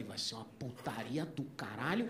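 hushed, gleeful whisper to himself; inner voice whisper. Retold or imitated. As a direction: whisper it like a secret thought. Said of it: "abri um parênteses na minha mente".

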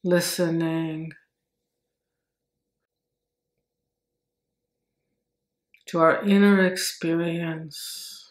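slow meditation voice, long pauses between phrases; guided meditation. Acted. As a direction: stretch the words out calmly to slow the breath.

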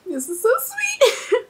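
high, slow, on the edge of tears; tearful. Real, not acted. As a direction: choke up over the sweetness. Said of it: "I'm not tearing up".

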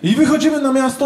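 ordinary talk, nothing marked

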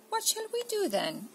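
put-on high whiny kid's question; bored child at a loss. Laura asks mum what to do, high child voice.

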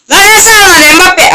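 high, incredulous yelling; outraged shout. Real, not acted. Gamer can't believe Mbappe missed, high outraged shout. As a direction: yell it high in disbelief.

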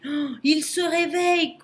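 high, alarmed wail with a gasped "oh"; frightened baboon in full alarm; panicked moan. A narrator's reading. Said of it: "gémit le babouin affolé".

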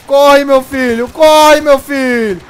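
loud, urgent call to run; urgent shout. Real, not acted. Gamer yelling to escape the police, loud and urgent. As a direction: shout at yourself to flee.

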